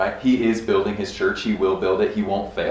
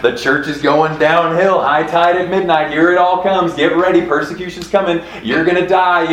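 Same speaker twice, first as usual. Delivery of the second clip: mock doomsday preacher; 6 semitones higher, 3 dB louder than usual. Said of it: "where they're like".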